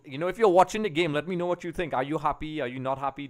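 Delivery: usual speaking voice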